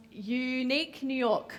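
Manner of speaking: unique New York said slowly, every consonant crisp; slow careful unique New York; deliberate diction drill; slow and clear. Shown as a demonstration. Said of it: "hit all the consonants in this".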